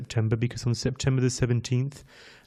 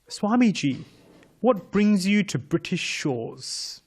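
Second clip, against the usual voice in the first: put-on high, slow, British reporter accent; 6 semitones higher, slower than usual. British reporter asks Swamiji what brings him, in a British accent. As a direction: put on a posh-ish British reporter accent, higher and drawn out. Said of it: "so I can do the accent quite well".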